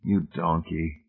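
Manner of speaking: soft, slow tender scolding. A narrator's reading. Hamilton softening toward weeping Marcia, soft slow tender tease. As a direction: gently tease her out of her tears. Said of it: "Hamilton's heart softened".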